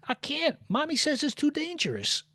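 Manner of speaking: put-on small boy trying to sound tough; little boy voice. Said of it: "this little fat boy who's trying to be tough".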